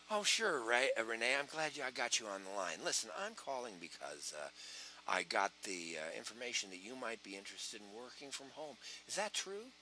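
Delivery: low, hushed, gentle phone voice; mirroring softly. Shown as a demonstration. Recruiter mirroring quiet Renee, low and soft. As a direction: drop down to match a quiet caller. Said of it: "It's called mirroring".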